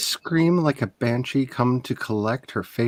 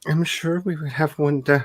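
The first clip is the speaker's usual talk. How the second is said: normal talking voice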